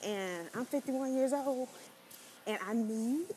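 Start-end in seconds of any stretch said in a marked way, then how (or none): none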